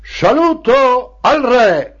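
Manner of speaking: high, slow ceremonial call; shouted salute. Acted. Party secretary to the assembled children, shouted salute. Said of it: "Il segretario gridava".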